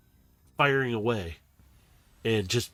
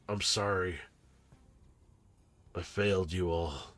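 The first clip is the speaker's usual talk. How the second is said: softer, lower, defeated; quiet defeated apology; 4 semitones lower, 5 dB quieter than usual. Acted. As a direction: say it like it's all lost. Robot Rex broken, apologizing.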